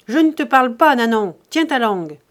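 loud, sharp scolding of the maid; harsh rebuke. A narrator's reading. Miser snapping at his servant, loud and angry. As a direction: cut her off hard, like a tyrant master.

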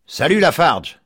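high, loud hail across the street; calling out. A narrator's reading. Mike hailing Lafarge from his doorstep, loud call. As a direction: call out a hearty greeting.

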